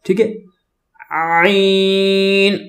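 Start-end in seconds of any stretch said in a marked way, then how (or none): 1.1-2.5 عین pushed out from the throat, long-held; drawn-out, throaty عین on a level note. Shown as a demonstration. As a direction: press عین out of the throat and sustain it. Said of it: "حلق سے آواز نکالیں گے".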